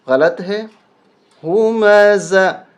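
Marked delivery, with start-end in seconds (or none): 1.4-2.6 ہمزہ with a clear z, tongue kept in; correct z in hamza. Shown as a demonstration. Teacher demonstrates ہمزہ slowly to students. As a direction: say ہمزہ slowly with a hissing z sound. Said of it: "یہ زا ہے، ذال نہیں ہے".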